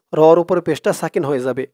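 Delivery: ordinary talk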